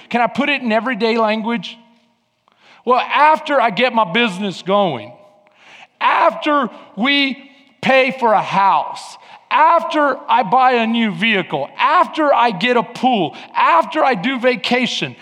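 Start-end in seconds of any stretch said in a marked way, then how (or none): 2.8-5.1 excuse-making voice, louder and higher; mocking Cain. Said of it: "This is what Cain's saying". 6.0-9.2 slow, "after" hammered louder; stress on after. Retold or imitated. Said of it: "This is what Cain's saying". 9.5-15.1 excuse-making voice, louder and higher; mocking Cain. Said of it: "This is what Cain's saying".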